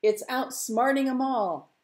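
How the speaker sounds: high, loud call over the water; crowing lookout. Acted. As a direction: call it out, drawing out the end. Fisherman calls out over water.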